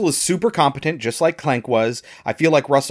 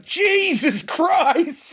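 stunned exclamation; much slower than usual. Retold or imitated. Shocked reaction to car explosion.

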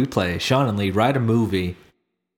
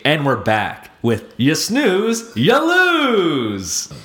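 slow, building host announcement; game show host; 6 semitones higher, 3 dB louder, much slower than usual. Pretend. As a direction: belt the title, higher and louder.

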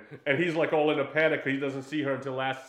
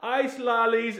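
higher, louder vendor's call; 9 semitones higher, 4 dB louder, much slower than usual. Retold or imitated. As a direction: hawk ice lollies across the beach. Ice cream seller calling on beach.

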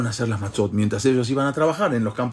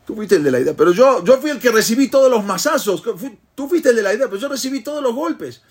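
indignant protest; 10 semitones higher, 3 dB louder than usual. Retold or imitated. Beaten friend protests the unfair deal.

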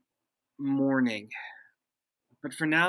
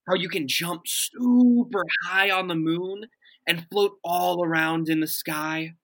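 ordinary talk

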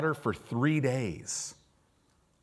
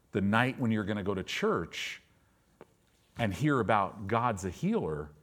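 ordinary talk

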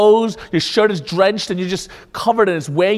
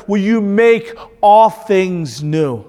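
usual speaking voice

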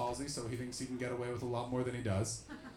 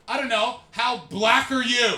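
retorting comeback; 7 semitones higher, 14 dB louder, slower than usual. Retold or imitated. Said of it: "He just looked at her and went".

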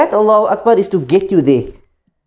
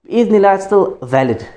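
ordinary delivery

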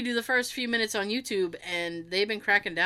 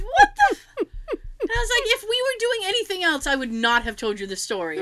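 high-pitched mock outrage, settling down; exasperated retold comeback; indignant protest; 11 semitones higher, 4 dB louder than usual. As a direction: start in a squeal, then argue it out.

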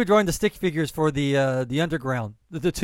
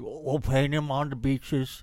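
slow mock-statesman impression; Churchill; 2 semitones lower, slower than usual. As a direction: drawl it like a droopy wartime orator. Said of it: "of Churchill".

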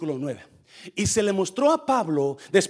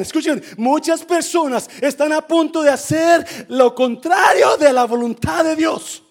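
full-force preaching; 8 semitones higher, 8 dB louder than usual. Real, not acted. Preacher reading Paul's vision to the church, full-force preaching. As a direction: preach it loud and high, stretching key words.

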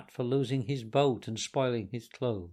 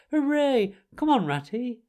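much higher, louder gleeful hooray; excited cheer; 11 semitones higher, 6 dB louder than usual. A narrator's reading. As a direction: burst out with greedy delight at supper. Toad cheers at supper.

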